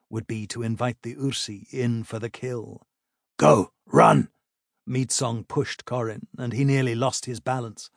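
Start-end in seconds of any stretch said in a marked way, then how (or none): 3.4-4.3 loud urgent order; urgent shout. A narrator's reading. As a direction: shove him on with the words. Meadsong pushes Corin forward.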